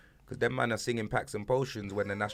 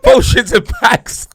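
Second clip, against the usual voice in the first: louder and higher, slow, laughing over the swapped title; 10 semitones higher, 17 dB louder, slower than usual. Real, not acted. Friend mocking a rapper's copycat title, slow laughing. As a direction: giggle out the reversed name.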